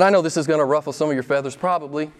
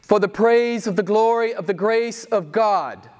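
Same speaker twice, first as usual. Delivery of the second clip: rising, emphatic preacher's refrain; preaching; 8 semitones higher than usual. Acted.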